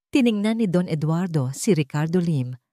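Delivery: plain reading voice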